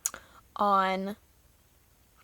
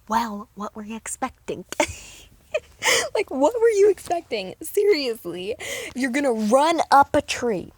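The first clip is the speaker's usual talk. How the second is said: exasperated; 4 semitones higher, 6 dB louder than usual. Real, not acted. Exasperated retort to teammate.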